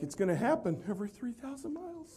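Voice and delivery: a laugh under a deadpan line; laughing joke. Retold or imitated. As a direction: tease him while laughing. Joking answer, laughing.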